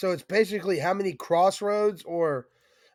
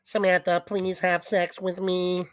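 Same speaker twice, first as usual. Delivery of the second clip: toy robot voice. Pretend. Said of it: "I am a robot".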